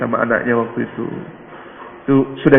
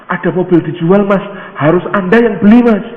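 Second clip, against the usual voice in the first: urgent urging; 6 semitones higher, 4 dB louder than usual. Acted. Villager pressing him to buy.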